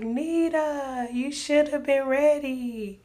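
high, slow, sustained otherworldly call; transcending mother. Retold or imitated. Mom turning into light warns daughter, transcending voice. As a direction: stretch the words like a voice floating away. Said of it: "transcending because she was turning into this light".

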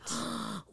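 fearful gasp. Retold or imitated. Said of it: "my fear starts sipping in".

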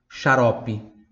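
ordinary talk

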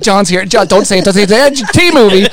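mock blurting. Imitates Scott failing to hold back.

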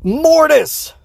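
imitating a high, excited yell; re-enacted full-volume shout of recognition; super loud shout. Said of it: "Super loud".

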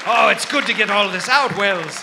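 loud, relieved exclamation; loud relief. Acted. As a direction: let it all out loudly. Relief after the outburst.

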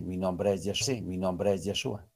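low, hushed retold voice; soft murmur. The Eternal revealing his name on the wind, hushed. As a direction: imitate a quiet divine voice. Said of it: "en un susurro".

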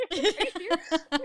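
drawn-out giggling disbelief, high; laughing, slowed. Retold or imitated. Replaying her answer to the kneeling fiancé, laughing slowly. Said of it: "'cause I started laughing".

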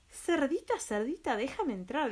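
high coaxing wolf call. Acted. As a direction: call sweetly to lure them out. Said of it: "dijo el lobo feroz".

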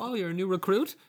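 mock-delighted recruiter; surprised harper. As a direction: harpers welcoming the goblin recruit. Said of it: "And they go".

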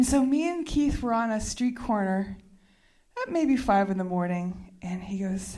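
usual speaking voice

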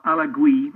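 Alagui ending in a "gwee" glide; second wrong pronunciation. Shown as a demonstration. Commentator showing another mistake, Alagui as "ala-GWEE". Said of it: "not Alagui".

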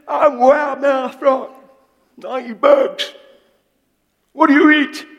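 the cocky frog shouting its greeting; boastful frog. Acted. Preacher playing the frog meeting the elephant in a high, loud squeaky voice. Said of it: "He says".

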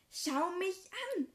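high, loud, slow demand; angry command. Acted. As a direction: bark it to cut her off. Draco cuts Liz off.

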